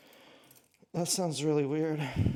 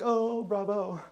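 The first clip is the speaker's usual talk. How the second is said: higher, mock-admiring praise; raised-pitch imitation of approval; imitated applauding crowd; 6 semitones higher, slower than usual. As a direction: hand out a put-on bravo. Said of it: "Everyone's like".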